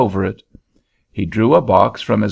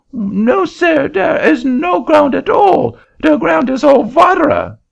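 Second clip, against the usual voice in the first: put-on very high, loud French-accented protest; an indignant Frenchman in a shrill-high French accent; angry French accent; 5 dB louder than usual. Frenchman refusing the auctioneer's seat, high loud French accent.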